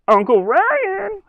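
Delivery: put-on very high, slow little-girl call; high child's call, drawn out; niece calling. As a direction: call out to her uncle like a toddler. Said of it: "sounded like this".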